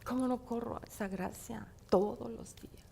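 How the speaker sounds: whispered question. Real, not acted. Preacher whispering a question to listeners. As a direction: lean in and whisper it.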